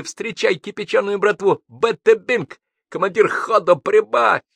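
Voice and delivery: high, loud, swaggering proclamation; manic exultation. A narrator's reading. Madman hailing the godfather and brotherhood, exultant shouting. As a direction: proclaim it grandly at high pitch.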